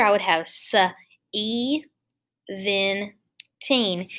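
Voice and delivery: put-on se-even-teen dragged apart slowly; wrong split. Shown as a demonstration. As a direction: say se-even-teen stretched and broken at each part. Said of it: "not how you say the number".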